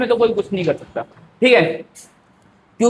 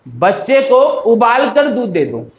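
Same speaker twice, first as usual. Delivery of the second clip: बच्चे को, a break, then उबाल कर दूध दे दो as one group; slowly, joining उबाल कर to दूध दे दो; pause after बच्चे को; slower than usual. Shown as a demonstration. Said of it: "एक तो ये बोल सकता हूँ".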